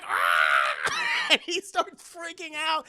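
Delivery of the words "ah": loud startled scream. Acted. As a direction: shriek of terror. Doll attacks mom.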